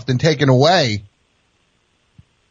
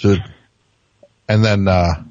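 ordinary delivery

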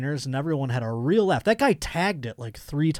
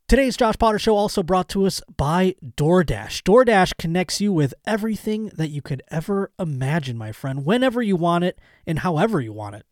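louder, upbeat sponsor-read voice; 3 semitones higher, 5 dB louder than usual. Acted. Host reading the DoorDash sponsor spot to listeners, upbeat ad read. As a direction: sell it like a friendly host ad.

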